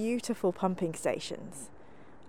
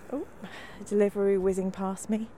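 ordinary talk

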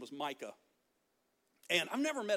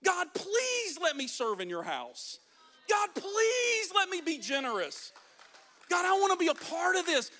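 high, stretched please, building louder; impassioned begging; 8 semitones higher, 4 dB louder than usual. Acted. Believer begs God for a chance to serve, impassioned high plea. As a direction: plead with God with mounting urgency.

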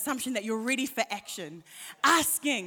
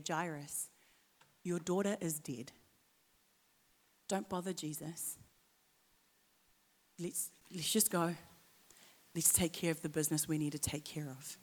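lower, softer voiced near-whisper; whispered news; 6 semitones lower, 9 dB quieter than usual. Messenger quietly telling Jairus his daughter died, soft. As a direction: lean in and break bad news softly. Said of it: "just whispers in his ear".

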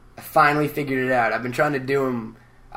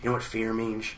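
quiet, lower, intense musing; Busey impression; 5 semitones lower, 12 dB quieter than usual. Busey explaining a word, low and hushed. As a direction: deliver like a wild-eyed guru. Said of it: "He's putting out a book".

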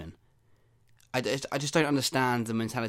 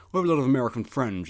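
brisk, louder, put-on voice quoting a snap; mock quote of a friend's snap; 4 dB louder, faster than usual. Podcaster mimicking a Snapchat friend's boast, quick put-on voice. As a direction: rattle it off like someone else's boast.

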